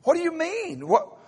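high, baffled husband voice. Retold or imitated. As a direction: play the clueless husband, voice up high. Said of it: "He said".

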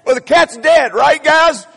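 loud, high mock yell of the blunt answer; mock shout. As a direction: belts out the news like an oblivious husband. Mocking a blunt husband.

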